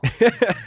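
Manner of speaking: sudden high laugh at his own joke; high burst of laughter. Real, not acted. Host laughing after roasting the man bun guy, high laugh.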